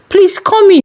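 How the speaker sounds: put-on high, bright, coaxing older man; eager pastor voice. A narrator's reading. Pastor coaxing Farida into his empty house, high eager voice. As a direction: lure her in with cheer. Pastor insisting she enter.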